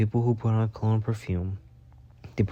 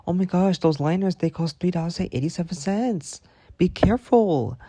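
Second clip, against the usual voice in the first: high, fretting mock voice of a coworker; imitating fussy coworker; 8 semitones higher than usual. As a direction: pitch it up and fuss like Tina over pennies. Imitating Tina again.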